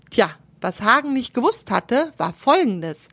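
plain reading voice

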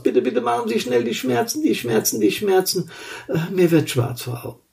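high pleading fading away; beg, then drift off fainting; overacted death scene. Injured man fades out.

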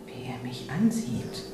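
unvoiced, slow whisper. A narrator's reading. Else watches Dorsti stare at her, whispering. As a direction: breathe it, uneasy. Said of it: "inner thought".

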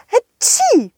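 schrilles kurzes Niesen; hohes Niesen. Acted. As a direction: ein helles Hatschi niesen. Plötzliches Niesen hinter ihm. (hohes gespieltes Niesen)

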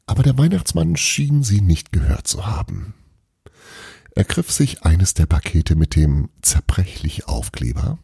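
plain reading voice

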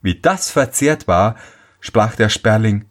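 ordinary delivery, nothing marked

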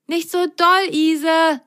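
a small sock calling out while swinging; little sock calling. Acted. Lu calls to Ise on the swinging vine, high and anxious. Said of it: "rief Lu".

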